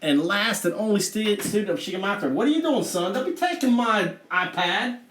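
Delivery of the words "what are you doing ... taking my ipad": high-pitched parental telling-off in a Southern accent; sharp, exasperated Southern parent. Real, not acted. Scolding son who interrupts the stream.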